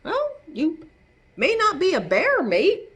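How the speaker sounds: ordinary delivery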